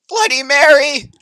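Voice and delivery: high loud disbelieving cry; loud shocked outburst; shocked shout. Real, not acted. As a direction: exclaim in horror. Shocked reaction.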